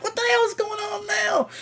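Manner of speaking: very high, strained disbelief; what on earth is happening; exasperated. Retold or imitated. Fed up, waiting twenty minutes for his order.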